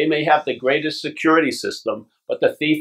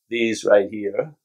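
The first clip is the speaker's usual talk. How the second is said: ordinary talk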